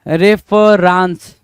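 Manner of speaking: रेफरेंस very slowly as "ref-fa-RAANS"; misspelling read out. Shown as a demonstration. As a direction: sound out रेफरेंस with a drawn-out "raans". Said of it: "रेफरेंस तो कुछ नहीं होना चाहिए".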